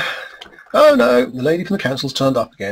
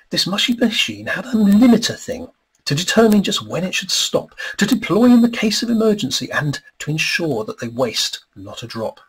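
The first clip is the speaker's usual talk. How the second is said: lilting storybook read-aloud, higher and bouncy; rhyming story narration; 9 semitones higher, 5 dB louder than usual. A narrator's reading. Author reading his rhyming book aloud to an audience, lilting storybook voice. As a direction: read the verse like a children's book.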